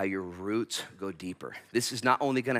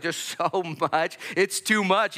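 laughing overwhelm; 9 semitones higher, 5 dB louder, slower than usual. Acted. Overwhelmed reader, acted.

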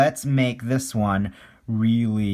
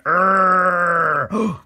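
high, loud, long mock growl; angry cartoon growl; 6 semitones higher, 7 dB louder than usual. Cartoonist turning stick man's face red, mock growl. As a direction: strain out a playful growl.

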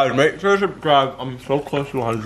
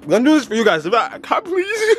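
high crying plea; pained pleading; 8 semitones higher than usual. Real, not acted. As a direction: plead through the burn.

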